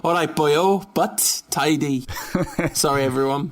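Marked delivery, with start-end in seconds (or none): 0.0-2.1 jokey put-on Welsh accent; stage Welsh, slowing on the last words. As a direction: lapse into a playful Welsh lilt. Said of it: "managed to stay away from the accent".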